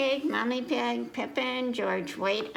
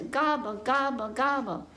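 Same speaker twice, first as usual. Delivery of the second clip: lightly voiced turkey gobbling, a touch higher; turkey gobble; 3 semitones higher than usual. Reader doing the turkey for kids, mild gobble. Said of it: "He says".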